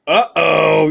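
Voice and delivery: loud teasing uh-oh; loud mock alarm. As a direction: sing it out like trouble's coming. Mock alarm again, louder.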